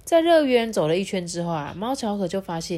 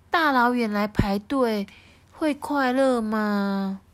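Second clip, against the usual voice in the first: wondering cat voice; 3 semitones higher, slower than usual. Acted. Xiaohua wonders in character voice.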